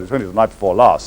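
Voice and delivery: normal talking voice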